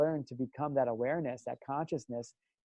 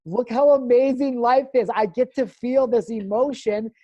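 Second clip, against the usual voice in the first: high, loud, drawn-out amazement; swelling, exultant wonder; acted awe; 7 semitones higher, 9 dB louder than usual. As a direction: marvel out loud, stretching the words. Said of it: "I was looking at it and observing it, going".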